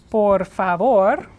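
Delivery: "Por favor" drawn out, rising on the end; careful Spanish pronunciation. Shown as a demonstration. Teacher models please in Spanish, slow careful pronunciation. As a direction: say "Por favor" slowly and firmly, stress on VOR.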